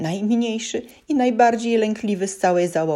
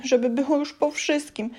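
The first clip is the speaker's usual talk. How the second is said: the repeat pitched higher, climbing in panic; second "żeby było już po wszystkim" pitched up in fear; rising panic; 9 semitones higher than usual. A narrator's reading. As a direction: say "żeby było" again, higher and more desperate. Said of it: "powtarzał sobie w myślach".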